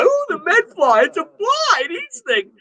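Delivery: high, hammed-up panicky cry over a fly; mock alarmed citizen. Remembering the Med Fly scare, mock-panicked high squeal. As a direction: squeal it like a scared Californian from decades back.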